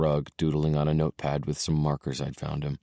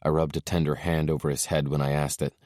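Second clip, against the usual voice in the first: ordinary delivery, nothing marked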